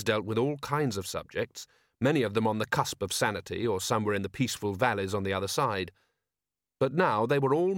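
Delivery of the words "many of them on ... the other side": usual speaking voice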